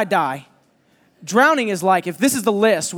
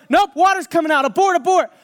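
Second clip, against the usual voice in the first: high, loud call to abort; alarmed shout; 9 semitones higher, 5 dB louder than usual. Retold or imitated. Friend calling alarm.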